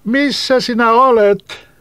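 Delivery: high, loud, slow call into the woods; boy shouting searchingly. Acted. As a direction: call out to an unseen voice. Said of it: "Silloin hän huusi".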